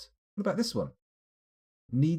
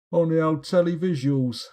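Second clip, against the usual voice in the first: comic posh voice; 6 semitones higher, 8 dB louder than usual. Pretend. Jokey repeat in put-on voice.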